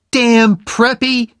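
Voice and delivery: high, loud, drawn-out curse; angry curse. A narrator's reading. Jenny curses Oliver as he walks away, angry. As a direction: fling it loudly after him.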